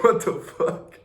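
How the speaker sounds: high, breathy, laughing what the fuck; breathless shock. Retold or imitated. Offered a sales job on the spot: breathless laughing shock. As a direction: gasp it out in laughing disbelief.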